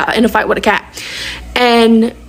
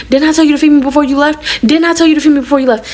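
fast, high mock scolding; scolding mother; 5 semitones higher, faster than usual. Mother hitting daughter over breakfast, rapid mock scolding. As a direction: rattle it off like an imitated angry mom.

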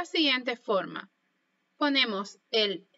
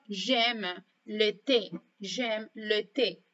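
slow, careful j'aime le thé; teacher demo, very slow; slow model phrase; much slower than usual. Shown as a demonstration. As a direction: spell it out gently for learners. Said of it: "como por ejemplo".

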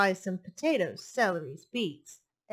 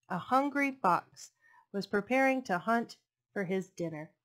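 plain reading voice

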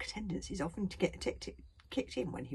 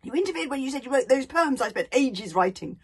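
angry rant; 8 semitones higher, 9 dB louder than usual. A narrator's reading. Fishlegs raging at Hiccup.

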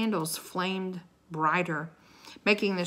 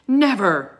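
loud, raised single word; forceful refusal; 4 semitones higher, 12 dB louder than usual. A narrator's reading. King forbids the children going, forceful. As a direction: forbid it outright, loudly.

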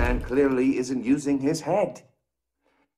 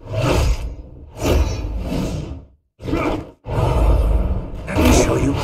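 much louder strained yell between blows; effortful fighting shout; 6 dB louder than usual. Acted. Villain yelling while brawling with Batman. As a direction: bark it out mid-brawl.

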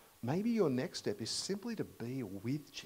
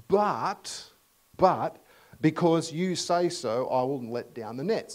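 emphatic reluctant reply; 3 semitones higher, 9 dB louder than usual. Retold or imitated. Peter's reluctant obedience.

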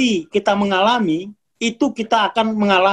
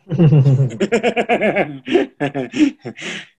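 a short real laugh; chuckling off the quip; laughter; 4 semitones lower than usual. Real, not acted. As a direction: laugh at the joke you just made. Laughs at his own quip.

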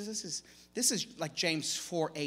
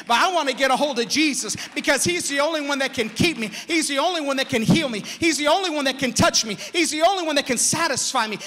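loud, fast, rising preached cry; full-force preaching; 5 semitones higher, 9 dB louder than usual. Real, not acted. Preacher to his congregation, full-force loud preaching. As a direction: preach it out, climbing each line.

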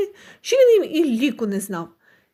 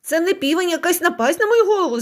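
exasperated shout; 6 semitones higher than usual. A narrator's reading. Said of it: "закричав пан".

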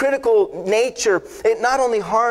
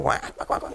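quiet duck quacks; 6 dB quieter than usual. Retold or imitated. Imitating duck calls.

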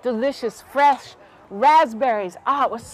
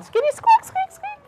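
very high squeaky chatter; tiny high mother-mouse squeaks; Mama Mouse squeaking. Acted. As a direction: give orders in mouse language. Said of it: "Mama Mouse said to her children".

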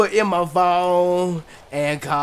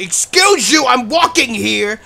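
louder, higher, slow indignant call; indignant shout; 3 semitones higher, 5 dB louder than usual. Real, not acted. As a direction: tell him off loudly. Snapping at passerby in game.

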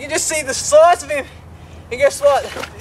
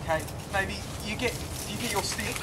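softly coaching a partner on the catch; hushed instructions; 2 semitones lower, 15 dB quieter than usual. Real, not acted. Quiet instructions during the catch.

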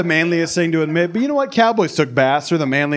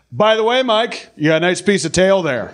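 yelling grandpa; 6 semitones higher than usual. Retold or imitated. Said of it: "my grandpa yells across".

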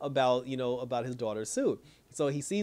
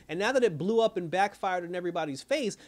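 ordinary talk, nothing marked

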